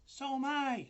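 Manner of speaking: very high little spider voice; second spider chiming in; tiny voice. A narrator's reading. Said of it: "another tiny voice".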